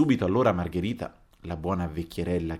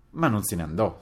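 plain reading voice

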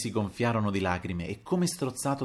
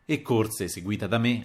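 ordinary delivery

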